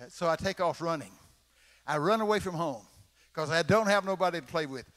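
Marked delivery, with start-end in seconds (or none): none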